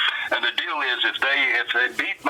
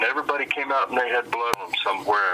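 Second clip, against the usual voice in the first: normal talking voice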